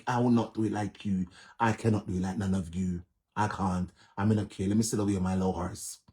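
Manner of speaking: usual speaking voice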